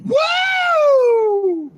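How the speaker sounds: a real high, loud whoop of amazement; host whooping at the caller's ticket price; excited whoop. Real, not acted. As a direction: cheer it out, genuinely blown away. Host reacts to eleven hundred price.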